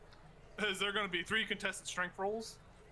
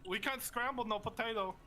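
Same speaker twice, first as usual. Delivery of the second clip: put-on wrestler's Canadian accent; 5 semitones higher than usual. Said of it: "That's a good accent".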